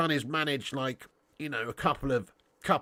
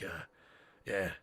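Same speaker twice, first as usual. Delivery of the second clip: raspy voice; 9 semitones lower, 10 dB quieter than usual. Retold or imitated. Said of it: "he's got too much of a raspy voice".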